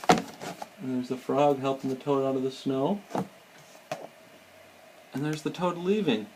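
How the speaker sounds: ordinary delivery, nothing marked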